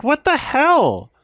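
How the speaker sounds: stunned what-the-hell; incredulous. Real, not acted. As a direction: react in shock to the scene. Disbelief at the film scene.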